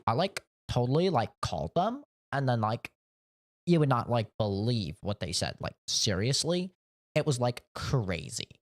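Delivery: put-on rising, bouncy Valley Girl voice; Valley Girl accent. Streamer mocking a coworker in a Valley Girl accent. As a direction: play the Valley Girl with every phrase lilting up. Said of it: "a Valley Girl accent".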